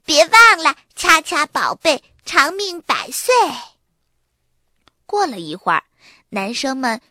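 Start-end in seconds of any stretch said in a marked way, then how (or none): 0.1-3.3 put-on high, loud little-girl reminder; 赶忙提醒. A narrator's reading. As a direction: pipe up eagerly to remind. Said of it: "多拉赶忙提醒说". (赶忙高声提醒)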